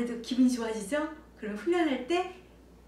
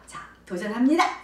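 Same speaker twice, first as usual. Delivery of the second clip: loud, rousing announcement of an attempt; confident challenge; 3 semitones higher, 9 dB louder than usual. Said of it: "자신감이 붙잖아요". (자신 있게 도전을 외치는 말투)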